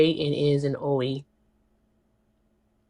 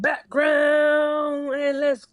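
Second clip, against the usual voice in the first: drawn-out shout; 8 semitones higher, much slower than usual. Real, not acted. S1 shouts slowly.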